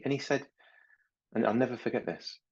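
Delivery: ordinary talk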